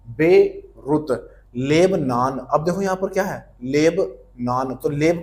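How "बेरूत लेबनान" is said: Beirut drawn out very slowly. Shown as a demonstration. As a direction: stretch Beirut syllable by syllable. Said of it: "slow repeat". (Beirut बहुत धीरे खींचकर)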